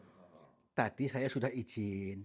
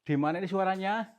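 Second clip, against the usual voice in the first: loud calls, a little higher; calling out; 5 semitones higher, 8 dB louder than usual. Real, not acted. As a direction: call out into the dark room. Calling out to the unseen spirit. (memanggil dengan lantang)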